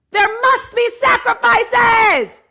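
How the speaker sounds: high, loud, slow proclamation; shouted all caps. Retold or imitated. Reading the diary's all-caps line as a booming shout. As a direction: bellow it like a war cry. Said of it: "All caps".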